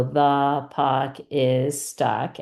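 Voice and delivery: "The puck is stuck" slowly, each word separate; measured, one word for each touched line. Shown as a demonstration. Teacher says it as student touches each line, word by word. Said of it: "touch each line as you hear it".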